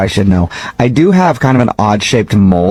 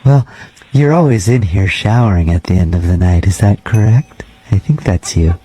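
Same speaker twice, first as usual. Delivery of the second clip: measured, slightly lowered janitor voice; lightly voiced creepy janitor; 4 semitones lower than usual. Night janitor cold-calling a gym member, lightly creepy voice. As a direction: play a polite, oddly intimate night janitor, understated.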